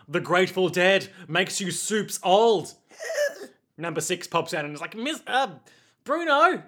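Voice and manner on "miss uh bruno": high-pitched New Zealand comic voice; Rhys Darby. Acted. Number six popping up to Bruno, Rhys Darby impression. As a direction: chirp it up high, calling for attention. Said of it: "like, Rhys Darby impression".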